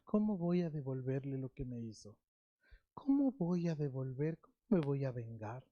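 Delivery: low soft plotting of revenge; quiet scheming. Pretend. Preacher voicing the cold person quietly plotting revenge. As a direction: mutter the plan for payback to yourself.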